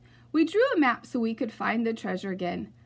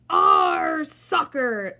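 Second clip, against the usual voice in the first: very loud, slow rough chant; crew yelling; 9 dB louder, much slower than usual. Acted. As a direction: drag it out as a rowdy crew. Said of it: "the crew yelled".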